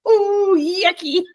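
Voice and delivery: high, drawn-out disgusted squeal; grossed out. Retold or imitated. Host reacting to a gory movie scene, grossed out. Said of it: "that was gross".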